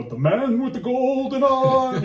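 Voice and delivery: high, slow, drawn-out spooky voice; spooky storyteller. Retold or imitated. Grandma spooking the grandkids, slow high spooky voice. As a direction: draw it out like a ghost story. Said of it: "And then she'd go".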